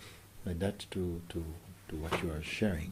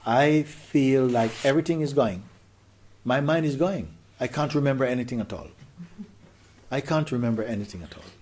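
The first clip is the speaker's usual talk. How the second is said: retold distress; 4 semitones higher, 5 dB louder than usual. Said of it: "she said like this".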